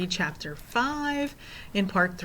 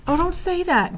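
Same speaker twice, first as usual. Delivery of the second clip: high, louder desperate plea; desperate cry; 12 semitones higher, 5 dB louder than usual. A narrator's reading. Ben protests at being sent home, desperately. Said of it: "Ben cried desperately".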